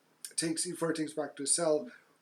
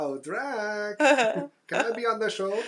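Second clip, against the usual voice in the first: higher, louder jokey plea; mock Lucy pleading; 6 semitones higher, 5 dB louder, slower than usual. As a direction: play Lucy begging to be on the show. Mock Lucy voice, joking skit.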